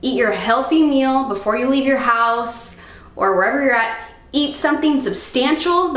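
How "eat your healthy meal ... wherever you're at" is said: ordinary talk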